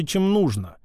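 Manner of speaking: ordinary delivery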